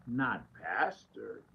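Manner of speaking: low and slow, deadpan denial; wife's flat answer. Retold or imitated. Wife asked how he really is at home, answers low and slow. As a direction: drop way down and drag it out, unimpressed.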